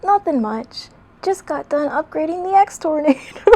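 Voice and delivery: put-on high, chirpy young fox voice; Tails voice. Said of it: "I can't keep a straight face when doing that voice".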